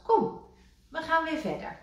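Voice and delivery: high, loud urging; Rad urging. A narrator's reading. Rad after rain to Kikker, high urging. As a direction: chivvy him along. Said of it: "zei Rad".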